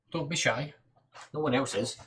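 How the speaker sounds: ordinary talk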